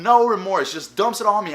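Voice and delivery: usual speaking voice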